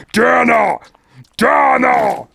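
shouted name, falling; yelling at Dana in the game; loud yell. Real, not acted. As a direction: call her out loud.

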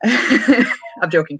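usual speaking voice

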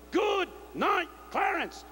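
high ringing good night; preacher's farewell shout. Retold or imitated. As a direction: call it out like closing the casket.

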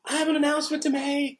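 hammed-up, attention-seeking declaration; grandstanding announcement. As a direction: announce it like a grandstanding big baby. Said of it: "in true grandstand baby style".

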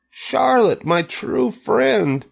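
high, drawn-out wail of grief; anguished moan. A narrator's reading. Wilbur mourns his true friend, anguished moan. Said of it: "he moaned".

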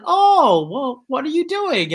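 playful retold surprise at running into him; mock surprised greeting. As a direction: replay your own surprised hello. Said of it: "I responded".

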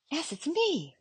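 high, bright call of relief; eager reply. A narrator's reading. Amy calling down to her grandmother, high and relieved.

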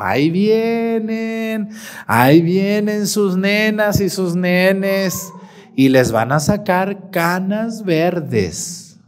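drawn-out, ominous teasing; teasing warning. Acted. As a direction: stretch every key word like a mock prophecy. Teasing warning of children to come.

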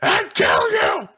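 sudden high shrieking yell; mock death threat. To hiding friend, a mock murderous scream.

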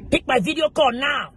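high-pitched, insistent demand; demanding partner. Acted. Partner checking up by video call, high demanding voice. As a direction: act out an impatient, checking-up partner.

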